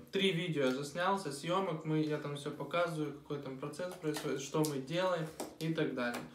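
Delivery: normal talking voice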